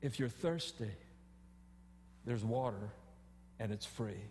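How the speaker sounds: soft, low, gentle invitation; gracious voice of God. Retold or imitated. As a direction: offer it gently, like grace. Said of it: "the gracious voice of God".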